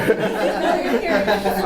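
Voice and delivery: high airy chuckle; sheepish laugh. Real, not acted. Teased by a student, sheepish high laugh. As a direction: laugh it off, caught out.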